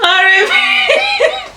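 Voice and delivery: laughing through the words; trying to stop laughing. Real, not acted. Still laughing at joke.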